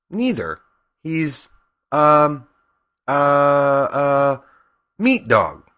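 put-on high, halting girl's answer; hesitant. A narrator's reading. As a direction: grope for the word with drawn-out fillers. Dorothy searches for an answer.

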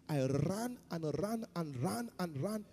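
slow, low, rolling repetition; endless running. Retold or imitated. Escaped captive telling how far he fled, slow rolling repetition. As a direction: stretch each "ran" in a swinging rhythm. Acting out the long run.

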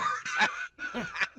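a high burst of laughter; real high laugh. Real, not acted. Laughs at own prank.

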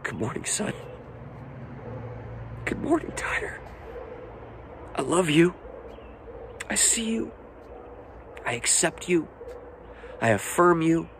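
hushed, high, tender blessing; intimate, emotional fatherly voice; Father God speaking. Acted. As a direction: speak softly as a loving Father, leaving space between phrases. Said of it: "The Father says".

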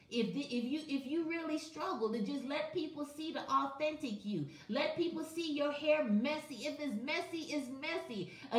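ordinary delivery

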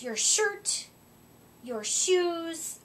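ordinary delivery

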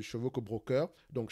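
ordinary talk

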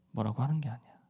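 Jesus gently reassuring Peter, softly; soft reassurance. Acted. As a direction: soothe him, bringing the repeat right down.

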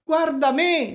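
the blind cat echoing the fox. A narrator's reading. (il gatto che fa eco, voce molto più alta e forte)

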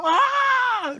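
high shrieking "Ah!" of disgust; mock shriek. Preacher shrieking in mock horror at shared water. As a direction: yelp it like you just saw germs.

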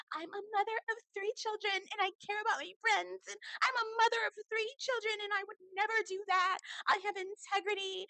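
very high, whiny mimicry; mock a self-righteous mom in a squeaky voice; hammed-up, high-pitched virtuous mother; mock sanctimonious mother. Mocking mothers who claim they can't lie.

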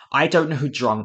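ordinary delivery

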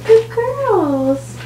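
high sing-song praise; pet baby talk. Shown as a demonstration. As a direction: coo at the kittens sweetly. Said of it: "my kittens like when I talk to them".

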